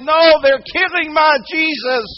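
high, loud, drawn-out cry; child's yell. Retold or imitated. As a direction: yell it like a distraught little girl. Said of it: "that little girl yelled".

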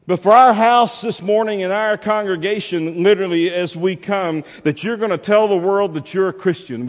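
loud, high pulpit call; ringing altar-call urgency; forceful preaching. Real, not acted.

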